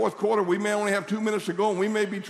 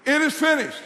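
loud, high congregational lead; ringing, emphatic statement; out-loud declaration; 9 semitones higher, 6 dB louder than usual. Shown as a demonstration. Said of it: "saying some things out loud".

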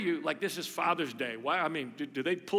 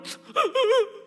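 high teary laugh; choked high laugh; tearful laugh; 5 dB louder than usual. Real, not acted. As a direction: laugh through tears.

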